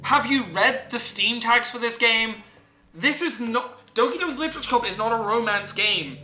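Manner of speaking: mock outrage. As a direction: scold the game character, hammering each word. Scolds game character in mock outrage.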